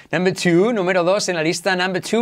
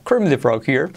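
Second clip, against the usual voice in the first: a hint of puppet frog voice; Kermit the Frog. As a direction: lightly imitate a chirpy frog puppet introducing itself. (imitación ligera de la rana Gustavo)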